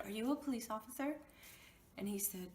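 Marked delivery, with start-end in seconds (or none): 0.1-1.2 fast, higher, rising disbelief; retelling asking a cop if he's real, fast and rising; quick incredulous question. Retold or imitated. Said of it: "I said".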